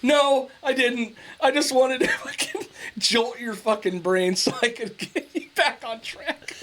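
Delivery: high, slow, laughing reply; laughing through it. Retold or imitated. Son retells his answer to mom, laughing high and slow. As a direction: giggle out the excuse. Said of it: "I was like".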